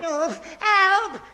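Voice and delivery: high, frightened yelps for help; dangling and calling for help; panicked cry. Acted. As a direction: scream as the ladder goes. Alfie hanging from the gutter.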